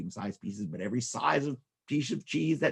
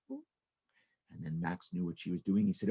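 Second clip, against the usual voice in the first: ordinary delivery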